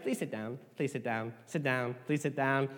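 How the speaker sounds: soft repeated polite plea; pleading nicely. Shown as a demonstration. Adult pleading with kids to sit, soft. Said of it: "with kids".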